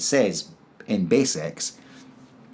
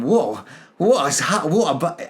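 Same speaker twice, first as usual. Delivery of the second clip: reeling in amazement, pitched up; giddy bewilderment; 7 semitones higher than usual. Retold or imitated. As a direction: act out stunned, half-laughing disbelief. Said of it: "but I'm kind of laughing".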